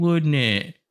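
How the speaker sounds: put-on high British-accented tag question; whiny English accent. Mocking the British to friends, high whiny English accent. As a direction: whine it like a mock Brit. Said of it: "This is how everybody should speak".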